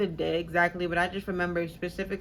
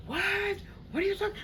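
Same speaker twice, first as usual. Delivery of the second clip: shocked what; 13 semitones higher than usual. Retold or imitated. Roommate's shocked reaction retold.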